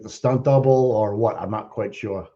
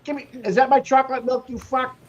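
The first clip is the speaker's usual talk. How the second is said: yelling across the room; 10 semitones higher than usual. Pretend. Continues the lunch thief rant.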